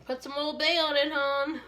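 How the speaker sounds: lighter Baltimore hon voice; Maryland accent. Retold or imitated. Repeating the Old Bay line for viewers, light Baltimore accent. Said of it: "You know you're a Maryland girl when".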